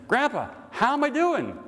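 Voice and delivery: put-on high, eager kid's call over the shoulder; child calling out. Boy on a bike calls back to his grandpa, high and eager. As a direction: call back to grandpa like an eleven-year-old.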